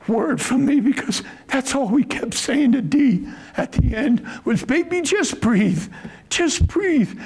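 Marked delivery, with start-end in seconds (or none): 4.6-7.2 tender plea. Retold or imitated. Pleading with dying Dee.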